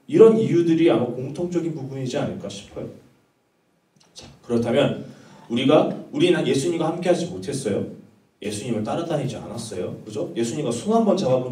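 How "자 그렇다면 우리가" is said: normal talking voice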